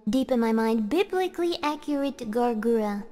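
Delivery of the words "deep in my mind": ordinary talk, nothing marked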